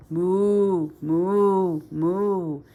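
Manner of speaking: loud, drawn-out moo; cow moo. Acted. Cow waiting for the farmer, mooing loud. Cow's call in story.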